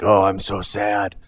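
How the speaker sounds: slow, put-on throat-straining moan; hammed-up mopey groan of self-pity; mock misery. Imitating Boone's self-pity, slow mock misery. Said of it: "that's why Boone's so miserable".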